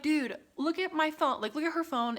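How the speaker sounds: mock exasperated appeal; retold pleading. Retells arguing with the doorman.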